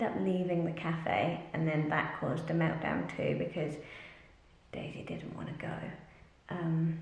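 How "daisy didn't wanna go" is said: hushed, secretive whisper. Real, not acted. Mum confiding to viewers about Daisy, whispered. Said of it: "I'm whispering 'cause I don't know if she's listening".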